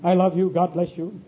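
low, soft, placid smiling reply; smiling self-control. Acted. Preacher greets the man coming to tell him off, low and smilingly calm. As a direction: answer with a hushed, practiced smile. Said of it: "I smile, I say".

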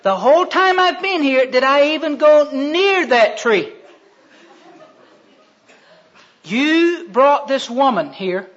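loud, drawn-out protest; indignant self-defense. Acted. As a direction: push the blame back, aggrieved and loud. Adam blaming God, acted argument.

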